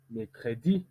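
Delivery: "mercredi" rising onto a stressed last syllable; final-syllable stress. Shown as a demonstration. Teacher giving the correct take, final stress on "mercredi". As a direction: land the weight on "di" of "mercredi". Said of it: "plus accentué au niveau de l'intonation".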